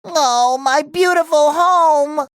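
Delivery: slow, drawn-out lament; mournful. Acted. Lamenting his house, mournful. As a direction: moan it in sorrow.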